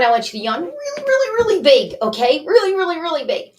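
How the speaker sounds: 3 egg them on to a huge yawn; 4 bubbly, pitch leaping up on really; 1 excited coaxing. Real, not acted. Coaching a big yawn.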